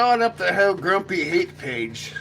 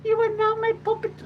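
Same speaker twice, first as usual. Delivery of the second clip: very high, thin alien voice; tiny alien declaring. Retold or imitated. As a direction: squeak it like a stern little green alien. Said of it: "they're like".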